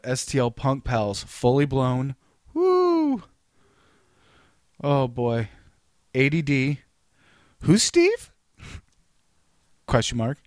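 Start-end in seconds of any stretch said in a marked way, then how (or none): 2.5-3.2 mock cheer. Mocking cheer in band list. 7.6-8.3 high squeal. Pretend. Reading band name mockingly.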